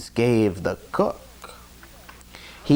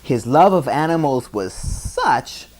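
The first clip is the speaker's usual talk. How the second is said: plain reading voice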